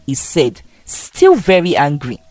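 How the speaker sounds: plain reading voice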